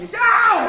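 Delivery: high, loud "ow" of mock pain; sudden comic cry of pain; pained yelp. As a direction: yelp as if snapped by a balloon. Balloon snaps magician, he yelps.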